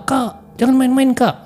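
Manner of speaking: high-pitched plea to a sibling; worked-up, high calling; teriak, acted yell. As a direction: call out high to stop the prank. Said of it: "Jadi dia teriak lah".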